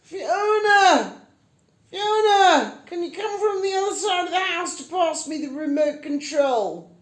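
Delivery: loud, high calling out for Fiona; impersonating Graham. Retold or imitated. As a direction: bellow across the house, high and loud. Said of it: "just do a quick impersonation of Graham".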